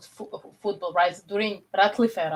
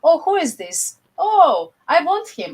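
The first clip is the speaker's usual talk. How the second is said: higher and louder, gushing over a new find; hammed-up squeal of wanting a player; mock starstruck buyer; 7 semitones higher, 6 dB louder than usual. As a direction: gush like a kid spotting a crush in a magazine. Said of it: "He sees".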